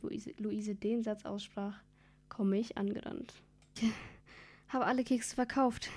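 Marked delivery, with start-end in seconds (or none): 3.8-5.9 lightly breathless after running; panting a little from the dash; mild winded report; out of breath. A narrator's reading. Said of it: "sag ich außer Puste".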